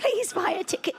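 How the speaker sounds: high pleading orphan voice; desperate orphan plea. Pretend. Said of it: "that is the most desperate".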